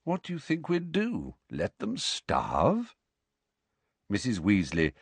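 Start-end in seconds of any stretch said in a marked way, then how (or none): 0.0-2.9 lightly smiling, slow teasing question; slight smile. A narrator's reading. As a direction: tease her gently, with a half smile. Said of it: "smiling slightly".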